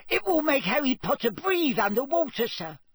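squeaky, high-pitched insistent elf; Dobby. A narrator's reading. Elf insisting Harry can do the task, squeaking. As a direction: squeak it with utter conviction. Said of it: "squeaked the elf".

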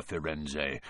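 low, soft warning of Firenze; quiet, stern. Acted. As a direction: say Firenze lowly and hushed. Bane's quiet reminder.

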